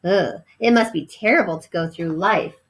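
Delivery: ordinary delivery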